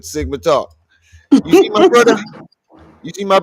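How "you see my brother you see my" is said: higher, louder Jamaican voice; a big mock Jamaican greeting; Sigma's Jamaican accent. Imitating his friend for the stream, in a high Jamaican accent. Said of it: "That's how Sigma talk".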